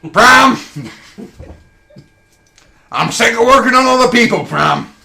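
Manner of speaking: high, loud furious outburst; angry robot shout. Acted. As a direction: yell in frustration at Bram. Acted robot quarrel.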